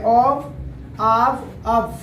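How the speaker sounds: ऑव, आव, अव as separate takes, only the vowel changing; three pronunciations. Shown as a demonstration. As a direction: list ऑव, आव, अव with a v ending each. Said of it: "three pronunciations होते हैं".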